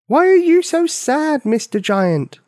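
high loud yell up at a giant; child's yell. Acted. Little girl yelling up to the giant. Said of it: "She yelled".

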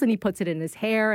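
ordinary talk

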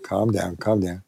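soft, low hush; calm him gently and softly; soothing. Retold or imitated. Comforting a weeping Mike, softly soothing.

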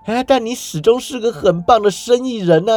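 high, nervous stammer; 结结巴巴地说. A narrator's reading. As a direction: stumble over the flattery in fear. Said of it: "石谷鸡结巴地说道啊".

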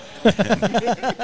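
loud high real laugh; big laugh. Real, not acted. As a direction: burst out laughing at your own story. Laughs at own story.

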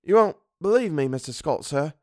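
hesitant Cockney servant, starting high; Cockney dialect. A narrator's reading. Servant fearing his employer's ridicule, in hesitant Cockney. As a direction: speak warily as a Cockney. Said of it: "Thomas's Cockney dialect".

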